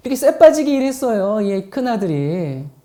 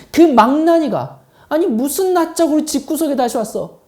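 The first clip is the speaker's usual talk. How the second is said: 격분한 형을 연기하며 쏘아붙이기; 믿기지 않는다는 듯 큰 소리로 터뜨린 뒤 분통을 쏟아내기; 길길이 날뛰는 큰아들; 4 semitones higher, 4 dB louder than usual. Acted. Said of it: "아마 길길이 날뛰었을 겁니다". (길길이 날뛰며 화내는 연기)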